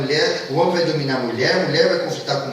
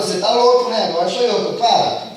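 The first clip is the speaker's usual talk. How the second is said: loud, higher outraged retort; indignant yell; 7 semitones higher, 5 dB louder than usual. Acted. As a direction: snap back at him loudly. Eve yelling at Adam.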